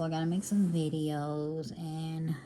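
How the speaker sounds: ordinary talk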